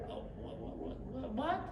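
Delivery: hushed mock astonishment; baffled disbelief. People react to his Texan nickname, hushed mock disbelief. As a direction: stammer it softly, almost voiceless.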